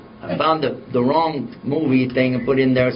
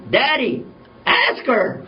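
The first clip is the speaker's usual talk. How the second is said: put-on high, urging little girl; high-pitched child's demand; little daughter; 10 semitones higher, much slower than usual. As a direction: imitate a daughter prodding her dad. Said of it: "She comes over to me".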